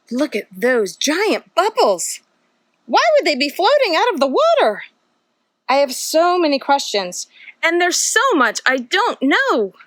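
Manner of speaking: put-on lively storybook read-aloud for little kids; reading to children. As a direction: read it like a bedtime picture book, full of wonder. Reads picture book to children.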